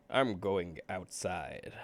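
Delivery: very slow, drawn out; slow drawl. Shown as a demonstration. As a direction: drag "I'm going outside" out slowly. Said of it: "I've gone all stuttered again".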